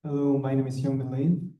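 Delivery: ordinary talk, nothing marked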